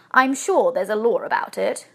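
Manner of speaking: mildly unconvinced objection; a touch of reluctance; doubtful. A narrator's reading. Husband still doubting the plan, mildly doubtful. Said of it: "said Mister Brown doubtfully".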